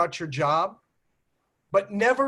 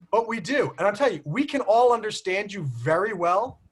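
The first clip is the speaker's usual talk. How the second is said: normal talking voice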